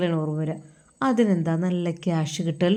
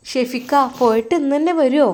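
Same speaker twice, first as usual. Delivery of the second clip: put-on higher, curious young wife; wife asking; 5 semitones higher, 4 dB louder than usual. Wife asks husband why he goes to madrasa, higher voice. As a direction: ask him sweetly, a pitch up. Wife's voice in story.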